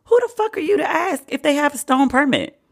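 high outraged question; high incredulous mock outrage; indignant disbelief. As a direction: demand to know, voice jumping up. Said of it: "I was like".

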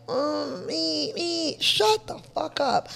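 mock a self-pitying crybaby.

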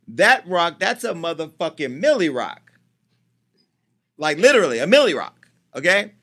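loud, punchy hype about the milli rock; loud hyped-up exclamation; emphatic. Real, not acted. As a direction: sell how huge that ring is. Hyping the huge engagement ring.

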